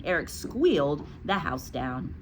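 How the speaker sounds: usual speaking voice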